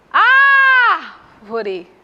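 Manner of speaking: loud, high call with a long-held "Ár" in "Árvore". Shown as a demonstration. Teacher calls "Árvore" to reveal the stressed first syllable, drawn-out call. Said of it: "eu posso chamar".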